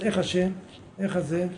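ordinary delivery

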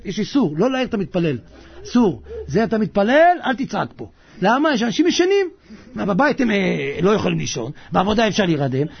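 ordinary talk, nothing marked

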